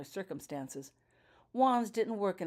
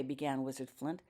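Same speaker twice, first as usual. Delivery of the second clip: ordinary delivery, nothing marked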